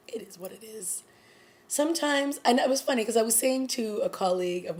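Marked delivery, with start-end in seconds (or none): 0.1-1.0 resigned whispered aside; hushed shrug to herself. Real, not acted. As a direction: breathe it out as a soft whisper. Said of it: "resigned aside after pause".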